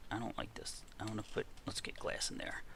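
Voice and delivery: muttered. Real, not acted. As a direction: mumble to yourself, uneasy. Worried muttering.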